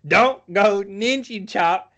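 high, slow, loud mock movie line. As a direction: drag it out high like a cheesy film quote.

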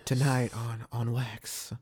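breathy late-night radio host; sexy voice. Shown as a demonstration. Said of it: "go ahead and give me that sexy voice".

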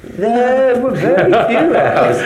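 high, drawn-out joking protest; mock-innocent denial. Director asked about rows on set, joking high denial. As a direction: stretch it out high and loud, playfully unconvincing.

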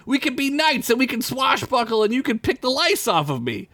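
high gushing voice; giddy boy. Acted. Derek dreaming aloud to Squeak, high and giddy. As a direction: gush with joy. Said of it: "Derek gushes".

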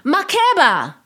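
piercing warning shout; desperate shout. A narrator's reading. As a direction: scream her name to stop the taxi. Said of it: "Was the shout".

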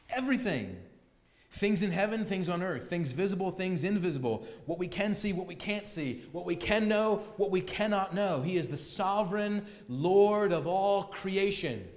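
ordinary talk, nothing marked

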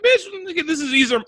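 high, loud, incredulous outburst; exasperated retort. Retold or imitated. The man snapping back at his clueless friends. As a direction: fire it back in disbelief.

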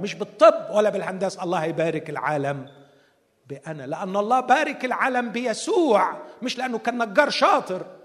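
loud, driving sermon delivery; forceful preaching. Real, not acted. Preacher to congregation, loud and driving. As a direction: preach it with full conviction.

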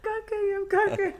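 giggling high and breathless; laughing through words. Real, not acted. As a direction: say it while cracking up. Repeating silly phrase laughing.

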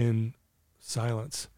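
ordinary delivery

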